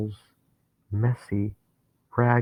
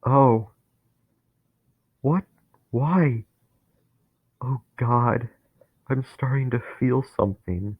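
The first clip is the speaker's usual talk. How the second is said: higher, louder, panicked voice; rising dread; 4 semitones higher, 3 dB louder than usual. Acted. Dennis feeling hate take him over, panicking. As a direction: feel the bitterness swelling, alarmed.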